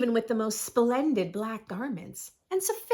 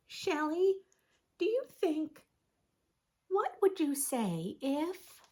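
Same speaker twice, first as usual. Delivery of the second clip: higher, tentative question trailing off; higher, unsure Vlad feeling out a friend; hesitant vampire friend; 6 semitones higher than usual. Acted. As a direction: ask it haltingly, not daring to finish. Character voices hesitant question.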